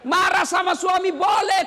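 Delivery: ordinary talk, nothing marked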